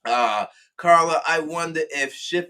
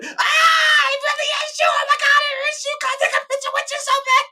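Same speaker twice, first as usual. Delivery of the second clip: very high, loud shrieking squeal; ecstatic fan; 5 dB louder than usual. Retold or imitated. Fan after he did the Quinn voice, shrieking. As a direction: shriek with starstruck joy, racing. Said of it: "as soon as I did the Quinn voice".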